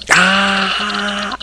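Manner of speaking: high pained scream; mock scream. Austin screaming in the hold, mock scream. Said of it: "Austin's like".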